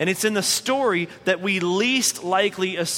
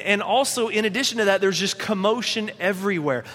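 ordinary talk

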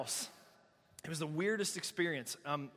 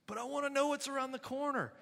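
high-pitched imitation of an objector; mock objection; 7 semitones higher than usual. Preacher voicing someone tempted by fortune tellers, high mock protest.

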